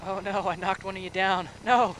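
rising alarm, ending in a high loud shout; dismayed cry. Real, not acted. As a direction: panic as it goes wrong. Accidentally knocking down teammate.

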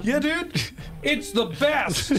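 imitating a drunk dude, high-pitched; drunk tourist voice. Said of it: "this guy goes".

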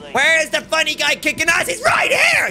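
high yell climbing to a scream; loud, very high-pitched hype shout; shouted boast. Pretend. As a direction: holler it, soaring on the last words. Shouted boast answering a chat message.

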